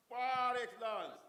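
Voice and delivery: loud, drawn-out announcer call; award proclamation. Real, not acted. Host announcing a steel plant team's award, loud proclaiming. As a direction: stretch it out and belt it to the hall.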